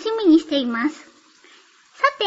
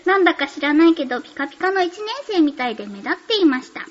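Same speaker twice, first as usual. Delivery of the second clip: ordinary delivery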